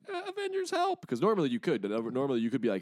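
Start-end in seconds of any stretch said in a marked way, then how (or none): 0.1-1.0 much higher cry for help; panicked plea. Retold or imitated. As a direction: call out for help in a thin high panic. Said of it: "Dex is like".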